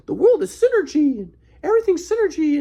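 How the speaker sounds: lightly lampoon an earnest lecturer; mocking grandpa. Grandson parodying grandpa's synergy talk, high mock voice.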